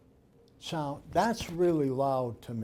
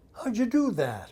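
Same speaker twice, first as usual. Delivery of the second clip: high, loud imitation of astonishment; amazed question; 8 semitones higher, 6 dB louder than usual. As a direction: play the astonished husband, pitch jumping up. Husband amazed at the video.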